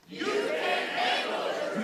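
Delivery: slow, intense, breathy growl of a line; Nicholson impression. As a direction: hit them with it like a colonel on the stand. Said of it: "Much better, much better, much better".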